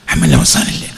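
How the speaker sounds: whispered, breathy repeat. Acted. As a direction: drop to a hushed whisper. (മന്ത്രിക്കുന്ന സ്വരം)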